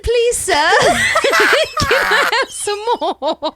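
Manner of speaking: high, hammed-up British urchin plea; British accent. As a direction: beg in a laughing mock British accent. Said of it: "her British accent comes out".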